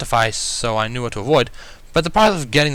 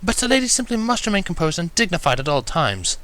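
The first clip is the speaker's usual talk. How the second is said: soft-spoken Rarity; 7 semitones higher than usual. A narrator's reading. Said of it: "Rarity spoke softly".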